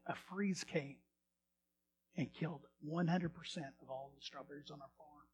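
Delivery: quiet, low, subdued retelling; retold tearful confession. Farmers telling of their killed crop, quiet and sorrowful. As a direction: drop low and soft for the bad news. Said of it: "then they started crying as they got".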